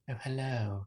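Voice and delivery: a hint of posh English; posh English accent. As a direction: lilt it like a refined English elf. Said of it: "High elves always seem to have the real".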